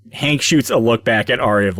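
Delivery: usual speaking voice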